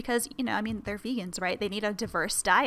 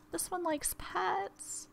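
softer cutesy pet voice; 9 semitones higher, 9 dB quieter, much slower than usual. Real, not acted. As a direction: coo it again, quieter and sweeter. Cooing over the rabbit.

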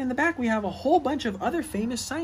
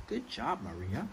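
quiet, gentle good job; soft praise; 8 semitones lower, 8 dB quieter than usual. Acted. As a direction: praise her softly, almost under your breath. Praising each scientist softly.

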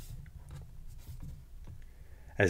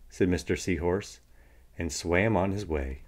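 ordinary delivery, nothing marked

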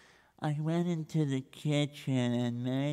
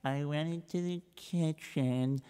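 soft, slow, dragging account; slow plodding recital; 6 dB quieter, slower than usual. Acted. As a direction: drag out every boring detail. Reb Israel plods again.

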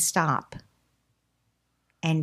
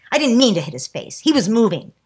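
defensive protest; 4 semitones higher, 7 dB louder than usual. Retold or imitated. Child defends himself.

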